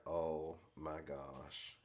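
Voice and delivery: slow, low and hushed; aghast reaction. Retold or imitated. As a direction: draw it out softly in dismay. Recapper's aghast reaction to insult.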